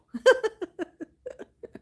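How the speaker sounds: high laugh; an amused cackle. Real, not acted. Streamer laughing at the idea of a scam caller.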